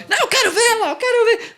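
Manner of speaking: put-on high, laughing, childish insistence; whiny high-pitched wife. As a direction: whine like a nosy woman who must look. Wife voiced insisting on looking.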